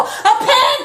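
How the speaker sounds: high, loud half-crying, half-laughing shouts; hysterical laugh-crying. Real, not acted. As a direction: come apart on the kitchen floor. Collapse, can't find pen.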